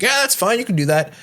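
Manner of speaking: high, casual agreeing Greg. Retold or imitated. Greg waving the bartender's offer through, high and casual. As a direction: toss off an easy high yes. Greg voiced in retold story.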